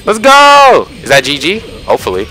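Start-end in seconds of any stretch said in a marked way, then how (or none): 0.1-0.9 high, loud triumphant yell; excited cheer. Real, not acted. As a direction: cheer on the winning play. Cheering own play in game.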